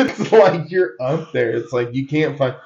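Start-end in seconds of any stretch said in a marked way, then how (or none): 0.0-1.5 laughing through the words. Real, not acted. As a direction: giggles while talking. Laughing at absurd plane answer.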